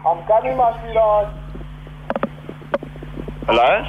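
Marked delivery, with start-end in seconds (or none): 0.1-1.3 high, raised call back to her. Pretend. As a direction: answer her sweetly from afar. Calling back to girlfriend.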